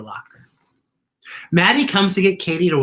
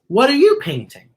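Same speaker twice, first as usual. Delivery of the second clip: higher, louder teacher question; friendly teacher voice; 9 semitones higher, 6 dB louder than usual. A narrator's reading. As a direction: ask warmly like a teacher. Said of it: "She asks".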